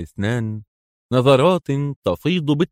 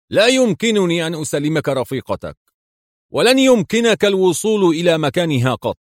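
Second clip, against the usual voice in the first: higher-pitched firm refusal; stubborn and stern; 7 semitones higher than usual. A narrator's reading. General refuses to hand over the captive, stubbornly. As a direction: refuse emphatically, pitch raised. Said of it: "يقول في عناد وصرامة".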